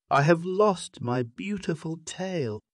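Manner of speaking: self-pitying lament. A narrator's reading. Monkey laments her lost tail.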